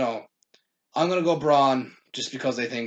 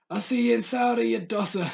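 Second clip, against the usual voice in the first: high-pitched imitation of a taunt; mock fighter's taunt; 8 semitones higher than usual. Fan mimicking a boxer threatening his rival, mock taunt.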